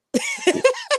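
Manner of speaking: squealing high laugh; quick high giggle. Real, not acted. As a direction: burst out laughing at her own joke. Joke about being in the hair.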